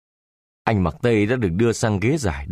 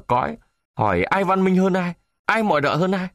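higher, fast indignant retort; flushed with anger; 7 semitones higher than usual. A narrator's reading. Traditional man rebuking his boastful companion, indignant and higher. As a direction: bristle and fire back at the insult. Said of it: "đỏ mặt lên".

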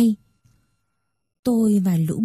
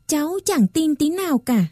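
arguing back; 6 semitones higher than usual. A narrator's reading. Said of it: "Tôi cãi bà".

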